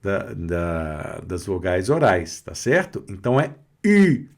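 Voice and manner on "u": "U" with rounded lips and fronted tongue; French u. Shown as a demonstration. Teacher contrasting with Portuguese, fronted French "U". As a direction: say "U" as French u, puckered like an ee. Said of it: "não se fala U".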